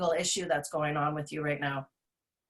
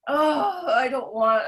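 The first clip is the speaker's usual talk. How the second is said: higher, louder whiny complaint; mock whine; 6 semitones higher, 6 dB louder, slower than usual. Mocking people stuck in between, a higher, louder whine. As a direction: whine like someone who won't commit. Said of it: "kind of whining about it".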